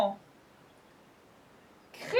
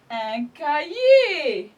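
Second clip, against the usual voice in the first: slow and louder, teacher model; slow clear model word; 6 dB louder, much slower than usual. Shown as a demonstration. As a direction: say it slowly for the children to repeat. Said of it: "C A H I E R". (lentement et fort, comme un modèle)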